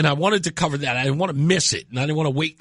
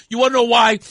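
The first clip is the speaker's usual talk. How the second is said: ordinary talk, nothing marked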